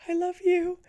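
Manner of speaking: hammed-up smitten confession; soft human inside. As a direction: blurt out love in a high, gushy voice. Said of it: "soft human in me is like".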